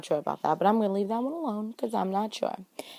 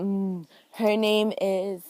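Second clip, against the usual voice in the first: slow, drawn-out trance-like stalling; stalling fake psychic; much slower than usual. Fake psychic stalling on the girl's name, slow dragging trance voice. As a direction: drag each "her name is" like a fraud buying time. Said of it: "She over there talking about".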